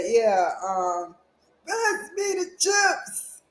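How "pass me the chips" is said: put-on high, slow shouting old man. Shown as a demonstration. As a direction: holler it like a cranky grandpa. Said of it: "my old man voice".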